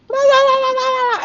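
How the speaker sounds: very high, loud singsong blah-blah; mock blah blah. Skipping her rant to her twin, in a high mocking singsong. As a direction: brush off the details in a squeaky mock voice.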